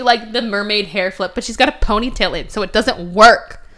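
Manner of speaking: ordinary delivery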